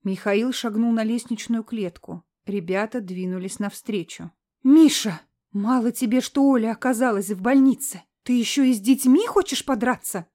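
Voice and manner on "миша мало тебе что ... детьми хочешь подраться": loud reproach. Acted. Old woman confronting son.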